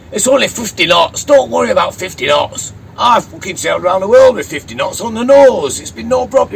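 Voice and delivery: loud, high boastful Yorkshire voice; Yorkshireman banter. Acted. Sailor shrugging off a gale to crewmate, loud Yorkshire voice. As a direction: brag at full volume like an old Yorkshire sailor. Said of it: "slipping into voices like this".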